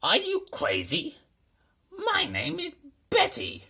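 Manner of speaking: high, loud, indignant monster voice; offended monster. A narrator's reading. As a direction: squeal it out as an insulted beast.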